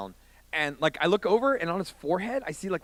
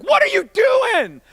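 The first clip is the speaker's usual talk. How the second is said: high, slow, stretched yell; alarmed mock shout; 10 dB louder, slower than usual. Mark grabbing his buddy's shoulder, high disbelieving shout. Friend grabs him, acted quarrel.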